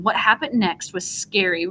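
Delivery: usual speaking voice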